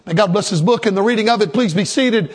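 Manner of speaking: usual speaking voice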